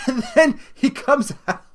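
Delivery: can't stop laughing while telling it. Real, not acted. Telling a friend about a fight video, laughing.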